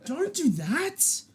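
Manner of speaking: laughing, high, slow don't do that; pleading. Real, not acted. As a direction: stretch it out, half laughing in disbelief. Repeated plea, second take.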